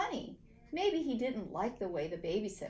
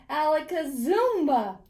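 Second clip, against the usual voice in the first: loud magic incantation; 6 semitones higher, 9 dB louder than usual. A narrator's reading. Boy casting his magic word, loud and proud. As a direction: shout the magic word with a flourish.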